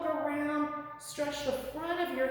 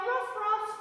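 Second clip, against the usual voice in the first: higher playful ruff ruff; puppy bark; 7 semitones higher, 3 dB louder than usual. Pretend. Teacher barking as puppy dog for kids, playful yap. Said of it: "let's see your puppy dog".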